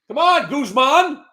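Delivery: loud, high, drawn-out call. Real, not acted. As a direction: call Guzman out teasingly. Calling out to a chatter.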